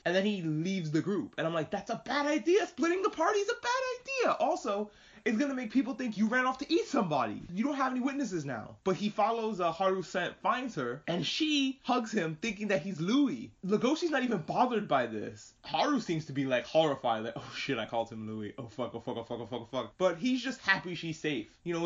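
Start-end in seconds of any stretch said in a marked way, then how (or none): 1.7-8.8 much higher, urgent pleading; exasperated warning. Real, not acted. Viewer warning Legoshi not to split up, high and urgent. 17.3-19.9 softer, frantic under-breath panic; panicked muttering. Retold or imitated. Haru realising she called him Louis, a soft panicked mutter.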